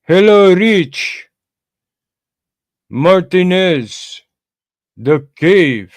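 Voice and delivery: high, loud put-on foreign accent, Martinez drawn out slowly; mock thick accent. Viewer in his chat greets him in a loud mock thick accent. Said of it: "or they got a thick accent".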